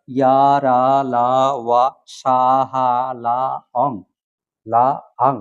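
level recitation of each syllable, "ya" through "ang"; reciting Pali letter sounds. Shown as a demonstration. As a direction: stretch "ya ra la wa sa ha la" evenly, then "ong". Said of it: "অনুসারটা পালিতে অং বা আং উচ্চারিত হবে".

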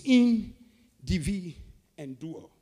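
"In, divi, and dual" split by clear pauses; syllable by syllable. Shown as a demonstration. Preacher teaching the word individual, "In, divi, dual" in separate parts. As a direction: sound out "In", "divi", "dual" one part at a time. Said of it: "It comes from three syllables".

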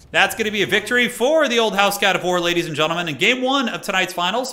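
high, loud victory call; excited announcer. Real, not acted. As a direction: call the win like a caster. Announcing the game one winner.

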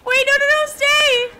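high, loud calling after the horse; frantic shout at the runaway horse; desperate calling. Real, not acted. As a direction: yell for it to come back. Horse runs away.

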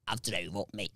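low, very soft mournful-sounding mumble; Chris. Retold or imitated. Friend telling the teller he drove up, low soft voice. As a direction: drop right down and murmur it. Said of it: "it's just how he speaks".

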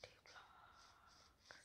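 hushed whisper. Real, not acted. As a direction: whisper it under your breath. Whispering while searching.

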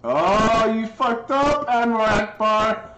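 high, loud gloat; loud, excited taunt, drawn out at the end; gloating shout. Real, not acted. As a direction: holler at a foe who blundered. Gloating at in-game opponent.

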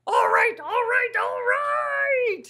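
high, loud burst of all right; fed-up monster shouting at the reader; exasperated Grover. Acted. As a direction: blow up in frustration.

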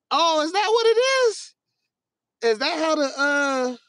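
high squeaky put-on surprise; mock surprise. Streamer mock-surprised at a chat user's riddle, squeaky high voice. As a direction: fake a high-pitched aha moment. Mock realisation about state codes.

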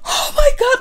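a high, delighted squeal for his win; proud squeal. Retold or imitated. Proud girlfriend at award, high delighted squeal. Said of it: "I would be like".

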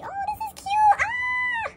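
loud, very high gushing scream; excited squeal. Real, not acted. Fan finding this is cute, excited squeal. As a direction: burst into a real fangirl squeal.

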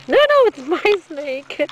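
high, slow cat voice; voicing the cat. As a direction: speak for a possessive pet, high and drawn out. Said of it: "he's like".

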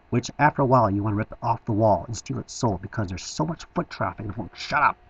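ordinary talk

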